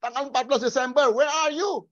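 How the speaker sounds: high, loud, demanding prayer; preacher parody shouting at heaven, pitched high; mock pushy preacher. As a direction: parody a preacher ordering God down. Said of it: "kata-kata hamba Tuhan".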